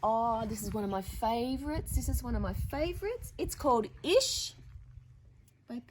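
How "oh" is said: plain reading voice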